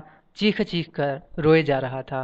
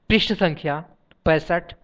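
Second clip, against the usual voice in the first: ordinary delivery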